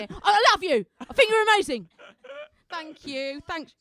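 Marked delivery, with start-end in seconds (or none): 0.2-1.9 loud, combative mock Cockney; aggressive London accent. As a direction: bellow affection like an aggressive Londoner. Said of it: "It's always so aggressive".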